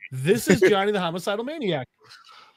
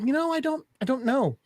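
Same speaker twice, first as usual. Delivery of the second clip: higher-pitched hesitant victim; 7 semitones higher than usual. Retold or imitated. Captive answering his tormentor, high and hesitant. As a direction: voice a captive unsure what to say. Voices chained victim in retelling.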